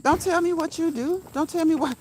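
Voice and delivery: high, sharp mock indignation from the client; indignant customer. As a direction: throw it back at her, offended. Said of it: "And the lady was like".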